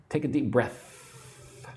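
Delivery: breath said slowly with a clear th; slow, tongue to teeth on breath. Shown as a demonstration. Teacher models breath slowly for learners. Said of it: "you need to lick your teeth".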